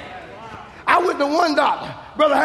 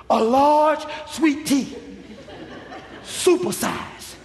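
slow teasing; 4 dB quieter, much slower than usual. Retold or imitated. Teasing the doctor before test.

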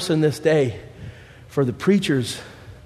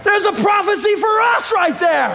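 preaching at full force; 4 dB louder than usual. Acted.

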